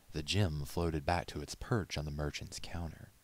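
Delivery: ordinary delivery, nothing marked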